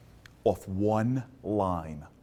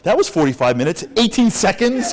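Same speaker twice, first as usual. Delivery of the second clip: high, very loud incredulous yell; outraged disbelief; 10 semitones higher, 14 dB louder than usual. Retold or imitated. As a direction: shout it in comic disbelief. Acted disbelief on the treadmill.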